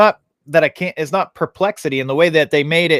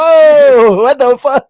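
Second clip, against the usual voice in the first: high, loud startled whoa; shocked; 13 semitones higher, 11 dB louder, slower than usual. Retold or imitated. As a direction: jump up in pitch, caught off guard. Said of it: "And I was like".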